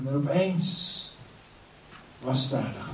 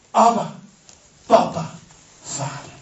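crying out; 7 semitones higher, 6 dB louder than usual. Acted. Calling out to the father.

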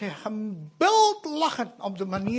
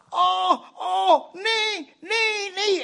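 frightened cries; 10 semitones higher, much slower than usual. Retold or imitated. Feverish boy sees Satan.

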